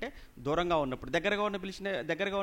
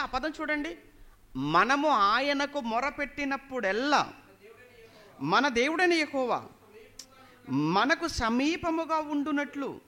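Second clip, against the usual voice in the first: high, slow preacher's reading with long-held phrases; scripture proclamation; 6 semitones higher, 5 dB louder, slower than usual. A narrator's reading. Preacher reads Deuteronomy to congregation, proclaiming. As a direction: proclaim the verse, stretching each phrase out.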